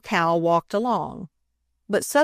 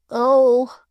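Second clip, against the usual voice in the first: pained moan; 6 semitones higher, 5 dB louder than usual. A narrator's reading. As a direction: moan in pain.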